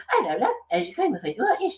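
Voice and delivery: high, squeaky comic impression; Joe Pasquale. Joe greets his own impressionist, high squeaky voice. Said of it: "He's going".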